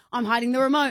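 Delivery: normal talking voice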